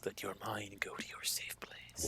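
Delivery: soft, voiceless whisper to yourself. Acted. Harry soothing himself.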